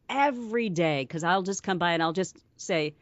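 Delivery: ordinary talk, nothing marked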